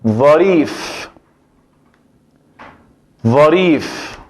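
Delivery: pronunciación árabe clara; Arabic word demo. Shown as a demonstration. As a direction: model ظريف for the student. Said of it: "cuando digo".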